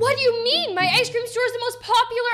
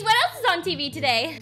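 usual speaking voice

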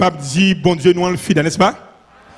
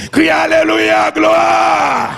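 high, sustained chanted shout; praise cry; 9 semitones higher, 3 dB louder, slower than usual. Real, not acted. Preacher leading the cry of the saved, chanted shout. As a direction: hold each word long on one raised note. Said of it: "c'est le cri des sauvés".

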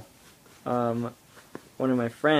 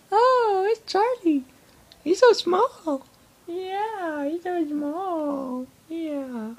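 dog voice; 4 dB louder, much slower than usual. Real, not acted. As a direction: coo at the puppy up high. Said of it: "That's my dog voice".

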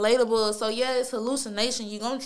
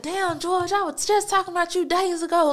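surprised greeting; 7 semitones higher than usual. Retold or imitated. Surprised greeting to George appearing.

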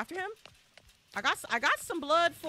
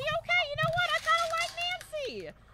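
delighted squeal. Real, not acted. Delighted by the character.

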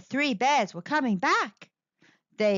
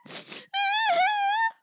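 high cub whimper. Acted. Baby Bear cries.

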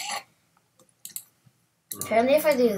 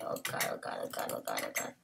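terrified gibbering; 16 dB quieter, faster than usual. Real, not acted. Fleeing monsters in panic.

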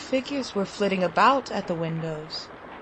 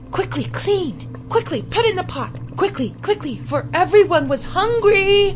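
excited; 9 semitones higher, 4 dB louder than usual. A narrator's reading. Hungry child imagining food.

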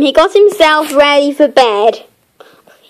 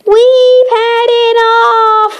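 character voice; 9 semitones higher, slower than usual. Acted. Character voice opens story.

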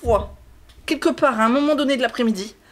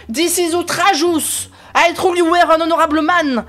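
hammed-up outrage; 7 semitones higher, 4 dB louder than usual. Voicing an indignant game character.

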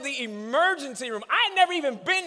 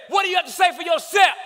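higher, loud, fast demand; demanding shout; 6 semitones higher, 3 dB louder, faster than usual. Retold or imitated. An angry dad demands his son explain, shouted. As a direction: demand an answer, hard.

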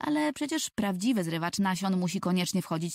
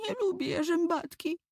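put-on much higher, tearful little boy; higher, lightly tearful child; sulky crying boy; 11 semitones higher than usual. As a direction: sulk through mild tears. Tomek crying after peck.

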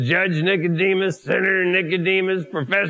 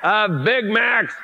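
slow emphatic; 5 semitones higher, much slower than usual. Acted. Exaggerating forty years of Big Macs.

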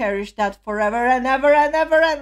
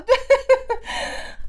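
high, overwhelmed laugh; giddy giggle; 12 semitones higher than usual. Real, not acted. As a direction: giggle helplessly at the memory. Fan giggles remembering the performance.

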